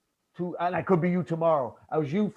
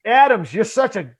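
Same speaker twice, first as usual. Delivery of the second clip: loud, high mock flattery of himself; mock self-praise; 6 semitones higher, 8 dB louder than usual. Praising himself while grabbing power bars, hammed-up mock flattery. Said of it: "mocking his own ego".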